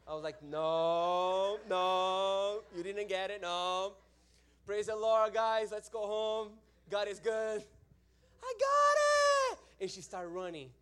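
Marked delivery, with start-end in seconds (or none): 0.4-4.0 long, loud drawn-out no; loud protest. Retold or imitated. Preacher stopping the warrior woman, loud protest. 4.6-7.6 raised, loud wrapping-up call; insistent praise. Retold or imitated. Preacher trying to end the meeting, insistent praise. Hurriedly closing meeting over her. 8.4-9.6 very high, loud yell; mock warrior scream. Warrior woman running off to help, mock warrior scream. Woman's battle cry retold.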